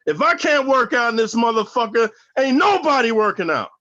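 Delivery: half-hearted shout at the whole yard; retold yell. Hauled off to the hole, yelling out to everybody in a mild mock yell. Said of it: "I yelled out to everybody".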